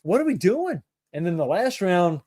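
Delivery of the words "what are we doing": exasperated, higher. Retold or imitated. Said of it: "it was like".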